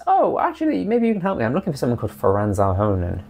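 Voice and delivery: high-pitched stranger asking for help. Retold or imitated. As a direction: pitch it way up like a meek townsfolk. GM voices random stranger.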